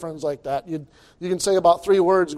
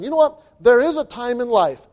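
raised, high preaching push; preaching emphasis; 6 semitones higher, 4 dB louder than usual. Real, not acted. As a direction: lift the voice to drive it home.

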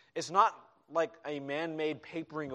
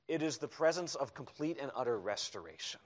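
usual speaking voice

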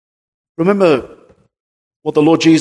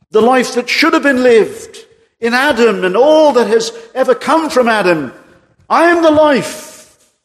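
preaching Jesus' words; 9 semitones higher, 3 dB louder than usual. Acted. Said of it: "But the Lord Jesus said".

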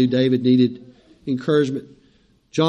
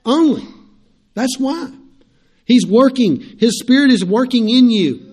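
building from raised to loud, high pulpit climax; preaching; 5 semitones higher, 7 dB louder than usual. Acted. Preacher asking the church why they persevere, loud climactic preaching. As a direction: swell it into a full preaching crescendo.

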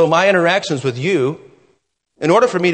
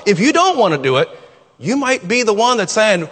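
ordinary delivery

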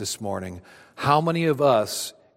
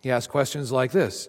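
ordinary talk

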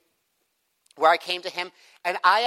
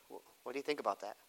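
much softer, quick Columbo-style probe; quiet probing question; 7 semitones lower, 16 dB quieter, faster than usual. Shown as a demonstration. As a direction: ask it low and fast as if musing. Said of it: "I'm still using the Columbo's approach".

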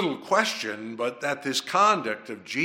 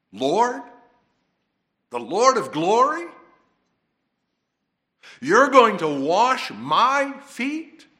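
incredulous protest; 9 semitones higher, 8 dB louder than usual. Acted. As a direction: plead in shock that the Lord would stoop to this. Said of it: "possible to put the emphasis on any one of those words".

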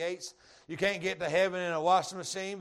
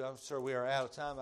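ordinary talk, nothing marked

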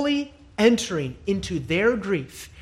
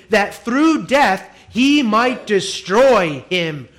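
full-force preaching; 4 semitones higher, 5 dB louder than usual. Acted.

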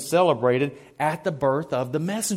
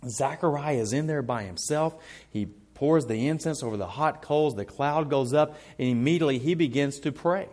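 usual speaking voice

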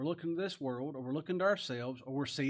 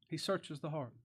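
"He searches the heart" repeated softer; softer echo; 5 dB quieter than usual. Shown as a demonstration. Preacher echoing "He searches the heart" to his listeners, softly. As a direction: let "He" settle quietly on the repeat.

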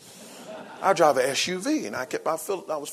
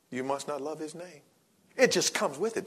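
usual speaking voice

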